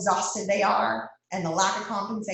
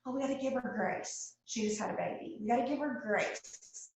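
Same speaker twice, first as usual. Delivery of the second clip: softer, airy imitation of people making excuses; mock excuse; 3 semitones higher, 8 dB quieter than usual. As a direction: lightly mimic a lame excuse. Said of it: "we use that word grace".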